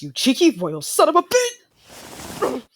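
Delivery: slow, loud, high furious curse; furious insult. Acted. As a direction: spit the insult word by word.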